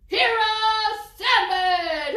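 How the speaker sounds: high, loud heroic announcement; triumphant proclamation. A narrator's reading. Narrator revealing Oscar's new nickname, triumphant shout. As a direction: proclaim it with fanfare.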